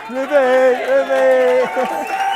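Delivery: high, loud pet-name call; fond excited call. Real, not acted. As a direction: sing out the nickname fondly. Nickname called out fondly.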